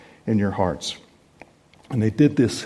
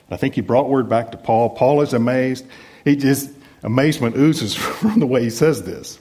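usual speaking voice

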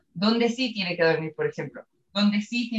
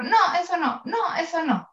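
higher-pitched repeated reprimand; insistent mock telling-off, pitched up; mock scolding; 7 semitones higher than usual. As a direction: pretend to tell off a dog. Acted scolding of the dog.

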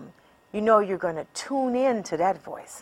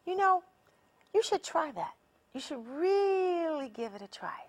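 higher, softer coaxing voice of the inner champion; encouraging inner voice; 8 semitones higher, 7 dB quieter than usual. Retold or imitated. Coach voicing the supportive inner self, higher and softer. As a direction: gently nudge yourself to try, pitched up. Who say.

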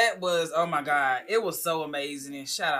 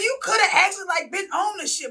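excited praise; 5 dB louder than usual. Retold or imitated. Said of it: "They was like".

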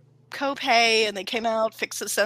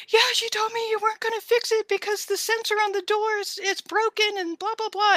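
high, strained retelling; upset explaining; 12 semitones higher than usual. Retold or imitated. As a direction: rush through it near tears.

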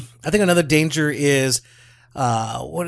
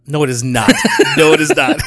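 laughing protest. Real, not acted. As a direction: object through real laughter. Laughing off a joke pronunciation.

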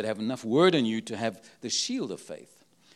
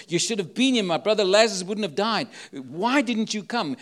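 reproachful cry; 5 semitones higher, 5 dB louder than usual. Acted. Preacher voices grieving sisters' reproach.

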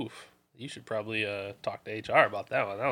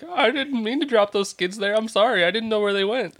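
high, pleading, slowed apology; scared apology; 10 semitones higher, 5 dB louder than usual. Pretend. Pretending to be the scared coworker, high and pleading. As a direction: beg forgiveness like a frightened kid.

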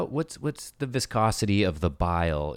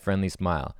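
ordinary talk

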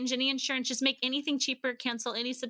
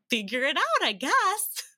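louder, rising comic shrug; carefree reply; 5 semitones higher, 5 dB louder, slower than usual. Retold or imitated. Daughter answering worried dad, comically carefree. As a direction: toss it back, climbing high at the end. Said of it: "And I was like".

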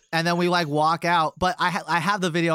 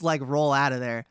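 normal talking voice

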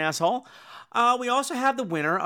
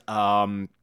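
slow, lower, hesitant mock answer; lower, slow, halting parody of a dull answer; mock dim actor; 4 semitones lower, much slower than usual. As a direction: drag it out like a clueless actor. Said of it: "the person that's asked just goes".

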